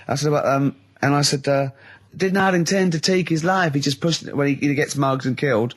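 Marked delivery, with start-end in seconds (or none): none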